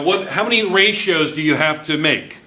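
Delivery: ordinary talk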